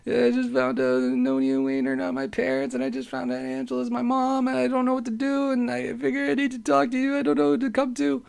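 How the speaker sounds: very high, whimpering mock sob; hammed-up tearful squeal, run together; imitated crying. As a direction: re-enact wailing through tears on the phone. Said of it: "called her horrendously crying".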